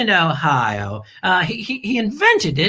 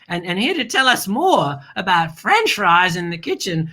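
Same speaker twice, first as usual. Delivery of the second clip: ordinary talk, nothing marked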